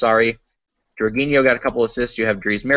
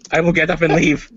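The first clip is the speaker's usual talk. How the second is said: put-on heavy Russian accent, menacing; Russian mobster voice imitating Mourinho; 2 semitones higher, 3 dB louder than usual. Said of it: "he sounds like a Russian mobster".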